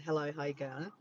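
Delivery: normal talking voice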